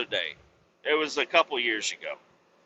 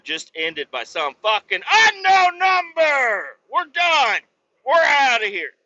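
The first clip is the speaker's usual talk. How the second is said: furious shout, much higher and louder; real angry outburst; 8 semitones higher, 5 dB louder than usual. Real, not acted. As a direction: bellow that it's all over, truly irate. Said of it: "I am fucking irate right now".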